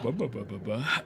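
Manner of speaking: put-on slow slurred drunken mumbling of a name; drunken mumble. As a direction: slur it like a drunk. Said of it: "sounds like y'all are drunker than me".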